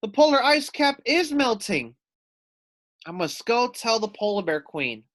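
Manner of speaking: high, loud panicked cry; alarmed. Acted. Foxy Loxy panicking that the ice cap melts. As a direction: blurt out the news in alarm. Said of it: "howled Foxy Loxy".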